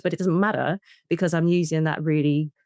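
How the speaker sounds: ordinary talk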